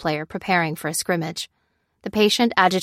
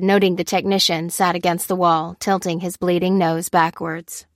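ordinary delivery

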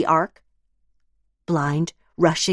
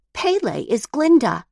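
put-on child voice; 10 semitones higher than usual. Child voices Glinda claim.

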